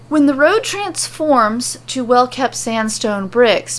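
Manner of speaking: plain reading voice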